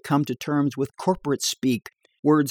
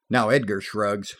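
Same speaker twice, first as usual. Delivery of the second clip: usual speaking voice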